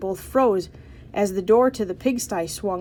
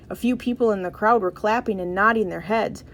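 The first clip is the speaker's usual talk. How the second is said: ordinary delivery, nothing marked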